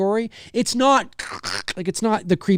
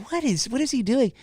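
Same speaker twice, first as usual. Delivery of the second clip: put-on confused kid voice; 7 semitones higher than usual. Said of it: "I just was like".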